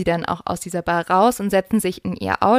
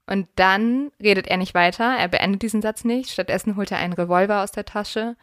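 normal talking voice

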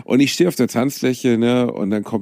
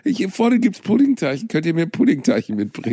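put-on much higher childish pleading; higher mock child begging; whiny begging; 13 semitones higher than usual. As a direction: whine like a kid for sweets.

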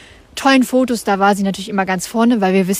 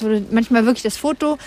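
ordinary talk

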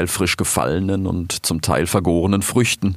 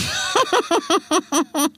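high gleeful villain cackle; villainous laugh. Acted. Aisha gloating over her plan, high cackle. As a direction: cackle like a lioness pleased with herself.

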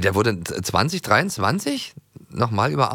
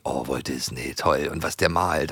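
mock awe; 6 semitones lower, 5 dB quieter, slower than usual. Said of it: "Und dann hieß es immer".

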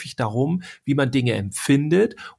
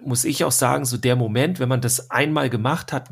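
ordinary talk, nothing marked

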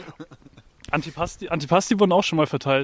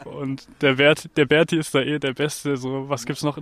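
normal talking voice

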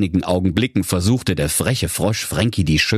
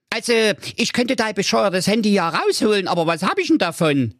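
high-pitched frog voice, haggling; grudging frog, pitched well up; cheeky bargaining frog; 14 semitones higher than usual. Acted. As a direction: bargain like a sly little frog.

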